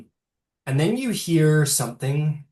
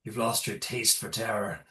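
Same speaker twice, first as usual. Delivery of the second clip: lightly voiced hobgoblin; 3 semitones lower, 9 dB quieter than usual. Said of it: "and says".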